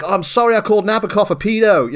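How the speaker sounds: a hint of a nerdy film character apologising; lightly voiced nerd. Podcaster quoting the nerdy dude with glasses, mock apology. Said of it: "this nerdy looking dude with the glasses".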